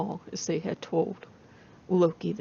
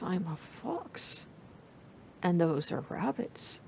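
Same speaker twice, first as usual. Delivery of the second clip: sly thought; 6 dB quieter, slower than usual. Acted. Fox eyeing two rabbits.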